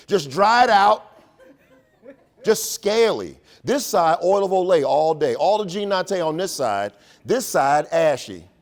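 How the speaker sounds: comic outrage at his own skin; laughing exaggeration. Real, not acted. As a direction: ham up the ashy skin. Showing face sides, laughing.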